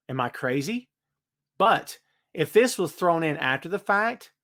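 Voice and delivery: ordinary delivery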